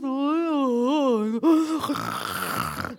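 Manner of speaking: slow droning sounds; mock droning preacher. Mimicking the church speakers, droning. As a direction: drone like a dull preacher. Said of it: "they talk like this".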